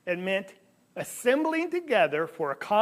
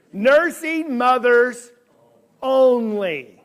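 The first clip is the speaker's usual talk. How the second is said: Nursing mothers only, drawn out slowly and louder; sign read slowly; 2 semitones higher, 7 dB louder, much slower than usual. Shown as a demonstration. Preacher reading the door sign to the congregation, slow and loud. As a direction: spell out each word of the sign slowly.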